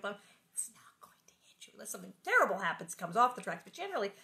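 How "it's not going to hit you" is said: whispered; hushed aside about trains. Real, not acted. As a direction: drop into a whisper.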